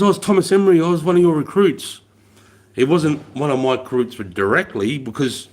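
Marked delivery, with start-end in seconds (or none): 0.0-2.0 higher, louder eager introduction; eager old recruit. Retold or imitated. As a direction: eagerly remind him who you are. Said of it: "He goes".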